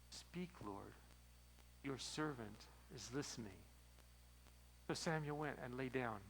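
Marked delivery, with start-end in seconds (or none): 0.1-3.6 much softer and lower, humble; a soft reverent answer to God; hushed. Retold or imitated. Eli teaching Samuel how to answer God, hushed. Quiet humble prayer reply.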